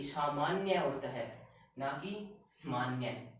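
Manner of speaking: सामान्य said slowly both times to show the long आ; slow the phrase and stretch सामान्य; careful contrast. Shown as a demonstration. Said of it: "बोल के देखिए". (सामान्य धीरे और साफ़ बोलिए)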